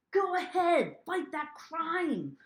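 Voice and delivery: high, drawn-out superhero cheer; mock-heroic rallying cry. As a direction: send them off like a playful superhero.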